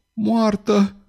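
high, drawn-out moan. A narrator's reading. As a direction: moan the word in grief.

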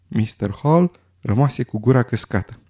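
plain reading voice

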